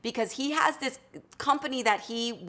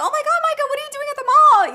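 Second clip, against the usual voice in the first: much higher, excited greeting; 10 dB louder, faster than usual. Retold or imitated. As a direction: squeal the greeting up high. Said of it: "I don't know why my voice goes high".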